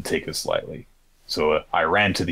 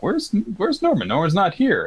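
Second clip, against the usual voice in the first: high, alarmed call for a missing friend; lightly voiced worried children; mock panicked kids; 3 dB louder than usual. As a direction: voice the kids asking in a higher pitch where Norman is. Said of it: "and everyone's like".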